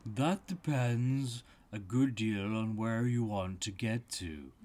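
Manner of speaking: lightly voiced storybook cat; hint of a talking cat; Cheshire Cat. A narrator's reading. Cheshire Cat answers Alice.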